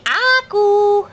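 put-on high sing-song word for baby; cooing first-word prompt; high coaxing prompt. Shown as a demonstration. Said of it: "Can you say".

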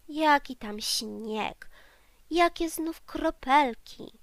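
plain reading voice

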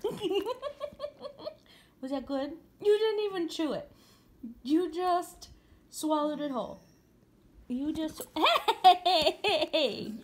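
high laughing exclamations; delighted loud teasing. Real, not acted. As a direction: laugh in surprise at the greedy dog. Dog gobbling the sausage.

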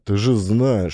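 normal talking voice

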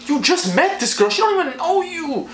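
high, incredulous reproach; exasperated scolding. Retold or imitated. Narrator telling Dave to get a grip, high exasperated voice. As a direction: climb in pitch with disbelief. Acted scolding of crying friend.

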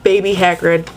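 ordinary talk

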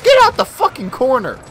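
high, loud hype, then shout at the campers; excited yell. Real, not acted. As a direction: yell at the enemy to leave the corner. Hyped then yelling at campers.

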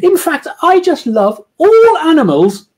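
high, loud, slow falsetto gush; hammed-up posh woman; gushing posh lady. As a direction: overdo the love of animals. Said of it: "doing women's voices".

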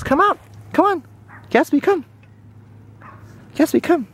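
high, sing-song coaxing; coaxing call. Real, not acted. Owner coaxing her puppy onto the grass, high and sweet. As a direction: lure the puppy out.

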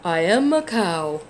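loud, slow, different cow voice. Acted. Cow answering the little bird, loud and slow. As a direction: boom it out slowly like a big cow.

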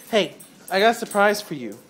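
ordinary talk, nothing marked

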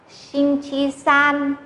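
"Sinh chi san" drawn out syllable by syllable; teacher's slow demo of "Sinh chi san"; slow model reading. Shown as a demonstration. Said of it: "tiếng Trung".

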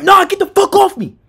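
high-pitched frantic protest, not full volume; mock scream. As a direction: reenact yelling while being held down. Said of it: "I'm screaming".

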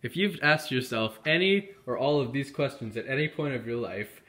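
ordinary delivery

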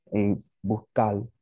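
put-on "buscar" in a Dominican accent, as "bukal", drawn out slowly. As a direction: say "buscar" slowly without the s, final r turned to l. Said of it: "Dominican guy".

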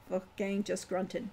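ordinary delivery

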